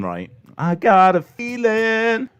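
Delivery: usual speaking voice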